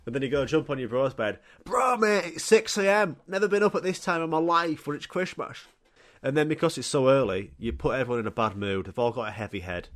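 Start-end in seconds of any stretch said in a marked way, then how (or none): 1.6-5.6 high, eager wake-up call; excited kid. Retold or imitated. Kid jumping on brother's bed at six, excited. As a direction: bounce on him and blurt it out.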